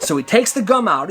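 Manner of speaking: ordinary delivery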